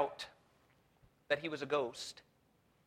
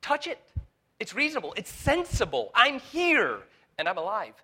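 much higher and louder, almost shouting; urgent Jesus; 8 semitones higher, 9 dB louder than usual. Acted. Preacher as Jesus urging the disciples to touch, loud. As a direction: call out to doubters, pressing. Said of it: "he asks them to touch him".

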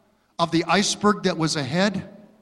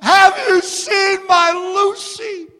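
high, slow, tearful plea; imitating a sobbing woman; 13 semitones higher, 6 dB louder, slower than usual. Pastor imitating a sobbing dog owner at her window. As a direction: play the frantic owner, near tears. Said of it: "she is sobbing".

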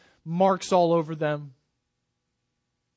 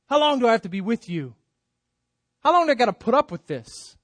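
exasperated, fast and raised; with disgust; 4 semitones higher, faster than usual. Acted. Preacher voicing Jesus's disgust at the disciples. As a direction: voice Jesus fed up with them. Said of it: "Almost with disgust".

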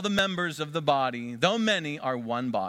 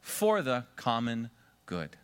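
said slowly, every word spaced; slow, drawn-out answer; slower than usual. Shown as a demonstration. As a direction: pastor leading the church in the answer, slow and deliberate. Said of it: "Let's finish it out".

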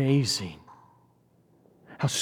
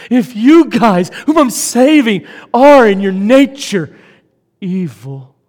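loud and high, hammering each phrase; emphatic rebuke; 7 semitones higher, 12 dB louder than usual. Acted. Preacher voicing Jesus to his apostles, loud and emphatic. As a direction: drive the point home at full volume.

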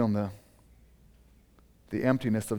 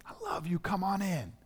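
tender father; 7 semitones higher, 7 dB quieter than usual. Acted. Father welcomes the forgiven child.

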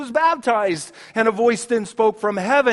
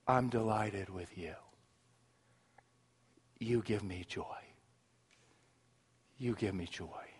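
hushed tender voice of God; 11 semitones lower, 14 dB quieter than usual. Acted. God telling every believer they delight him, in a hush. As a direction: murmur love to a beloved child.

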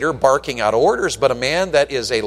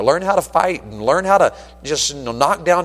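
ordinary talk, nothing marked

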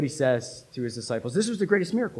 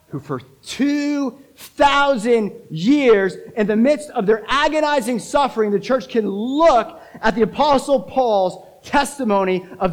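loud, high and drawn-out pulpit cry; full-force preaching; 7 semitones higher, 7 dB louder than usual. Real, not acted. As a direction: stretch the key words with fervour.

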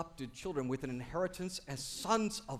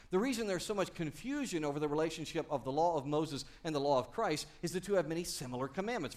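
usual speaking voice

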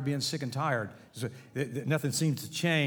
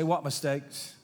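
gentle God; slower than usual. Acted.

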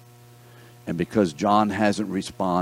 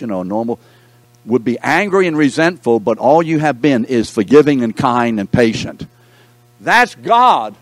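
preaching; 4 semitones higher, 7 dB louder than usual. Acted. Preaching climax, rising force.

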